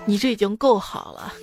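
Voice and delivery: ordinary delivery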